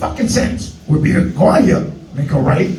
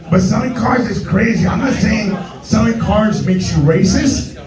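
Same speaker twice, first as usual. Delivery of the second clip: ordinary delivery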